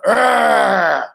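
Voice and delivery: loud animal growl. Retold or imitated. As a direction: roar it like an angry fighter. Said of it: "he just growled".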